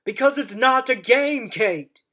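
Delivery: loud, impassioned speech building to a shout; booming rousing general speech. Acted. Doctor's rising speech about war.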